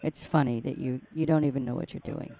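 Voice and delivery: usual speaking voice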